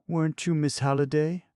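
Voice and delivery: high, fluttering society lady; fluttery. Acted. Poetess fluttering at Eve.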